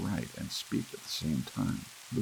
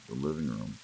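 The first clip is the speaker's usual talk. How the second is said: usual speaking voice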